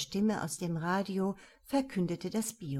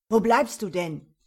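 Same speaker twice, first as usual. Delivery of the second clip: loud, sharp and irritated; venomous snap; 9 dB louder than usual. A narrator's reading. Young colleague snaps at late Emil, irritated. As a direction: snap at him for being late. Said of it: "giftete ihn sein zehn Jahre jüngerer Kollege an".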